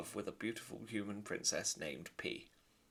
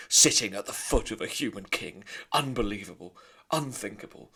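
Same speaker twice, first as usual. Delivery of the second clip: much louder, outraged; shouting father; 10 dB louder than usual. Acted. Lester shouting at his wife, outraged and loud. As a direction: bellow in outrage. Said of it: "he shouted".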